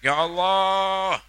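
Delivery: high, loud, slow outcry; cry out. Shown as a demonstration. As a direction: cry out in distress. (teriakan memohon)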